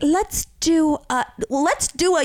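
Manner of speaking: slow, high mocking repeat; mocking customer. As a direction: drag out the phrase mockingly. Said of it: "I was like".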